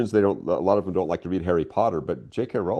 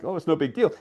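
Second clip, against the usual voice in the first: breezy, offhand dismissal, pitched higher; mock casual shrug; 8 semitones higher than usual. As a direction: toss it off like it's nothing. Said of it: "sort of like a throwaway".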